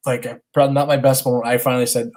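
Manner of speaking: normal talking voice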